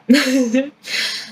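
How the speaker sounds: brief real chuckle; sheepish chuckle. Real, not acted. Vlogger admits joining a nearly finished project, chuckles. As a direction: chuckle after a small confession. Amused after admitting she joined others' work.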